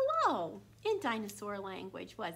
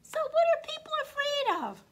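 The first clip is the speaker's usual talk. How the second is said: much higher, louder dinosaur; dinosaur's voice; 9 dB louder than usual. A narrator's reading. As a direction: ask it as the big dinosaur. Dinosaur voice asks a question.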